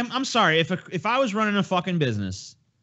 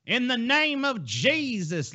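slow, high, drawn-out mock plea; mock pleading; 9 semitones higher, 3 dB quieter, much slower than usual. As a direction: imitate a fervent cry for Jesus, stretched and high. Mocking the clip's woman's plea.

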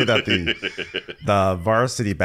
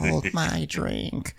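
slow, high and soft; lightly voiced smug hostess; Catherine impression; 8 semitones higher, 4 dB quieter, much slower than usual. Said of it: "Catherine comes in and she's like".

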